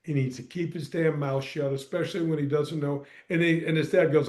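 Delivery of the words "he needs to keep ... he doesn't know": low, soft, muttered complaint; a retold grumble kept under the breath; hushed retort. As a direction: keep it quiet so only one hears. Retold angry remark, hushed.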